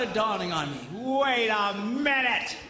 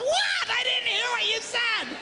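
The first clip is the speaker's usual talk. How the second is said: mock shout; 11 semitones higher than usual. Husband yelling over highway noise to his wife, high mock shout. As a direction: holler back that you can't hear.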